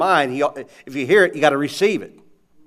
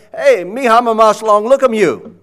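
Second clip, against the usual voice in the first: Papua New Guinean Pidgin greeting, called out louder; 3 semitones higher, 9 dB louder than usual. Retold or imitated. Pastors greeting the preacher on arrival, loud Pidgin hello. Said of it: "a language called Pidgin".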